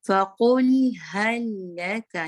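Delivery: loud, slow chanted recitation; slow Quran recitation. Shown as a demonstration. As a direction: recite it slowly, keep the lam still. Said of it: "लाम हिलाना नहीं है".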